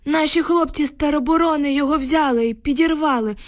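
put-on high-pitched old man's voice, upset; agitated old village man. A narrator's reading. Shaken neighbour reports to the women, agitated old man.

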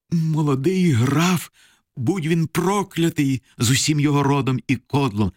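high-pitched, bitter cursing; anguished curse. A narrator's reading. Mother curses the young count and his kin, high and anguished. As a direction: raise the voice in pained outrage.

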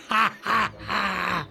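high, stretched-out mocking cackle; acted robber's gloating laugh; villainous guffaw. A narrator's reading. Robber mocking hungry family, high gloating guffaw. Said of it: "зареготав Смердючка".

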